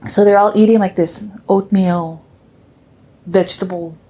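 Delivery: ordinary delivery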